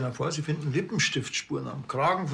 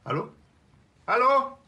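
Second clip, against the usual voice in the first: calling hallo; 10 semitones higher, 4 dB louder than usual. Acted. Caller hung up on phone.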